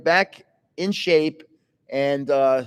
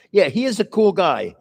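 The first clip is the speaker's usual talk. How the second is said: ordinary talk, nothing marked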